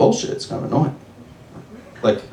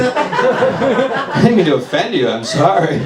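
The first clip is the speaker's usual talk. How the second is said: higher, slow apology broken by laughter; laughing apology; 6 semitones higher, slower than usual. Retold or imitated. Comic replaying his apology to the fortune teller, laughing. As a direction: laugh your way through the sorry.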